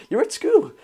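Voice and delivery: high, teasing disbelief; mock-amazed reply to a schoolkid; amused surprise. Real, not acted. Teasing a young viewer.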